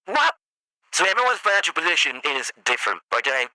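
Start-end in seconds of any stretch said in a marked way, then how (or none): 0.1-0.4 shocked what. Retold or imitated. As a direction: shout it in disbelief. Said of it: "I'm like".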